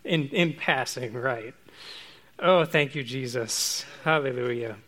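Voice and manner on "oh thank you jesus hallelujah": usual speaking voice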